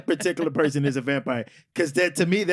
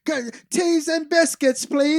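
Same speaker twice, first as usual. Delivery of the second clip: high, loud mock British accent; attempted British accent; 10 semitones higher, 5 dB louder than usual. Shown as a demonstration. Podcaster proving he can try, high-pitched bad British accent. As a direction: put on a hammed-up British voice. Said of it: "you can do British accents".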